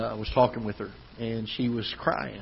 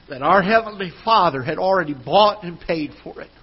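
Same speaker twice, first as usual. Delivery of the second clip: raised, louder preaching voice; 5 semitones higher, 4 dB louder than usual. Acted. Pastor preaching to his church, raised and forceful. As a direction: preach it out to the congregation, building.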